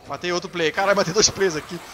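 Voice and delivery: loud, exclaiming triumph; excited boast. Real, not acted. Gamer just killed two players, excited boast.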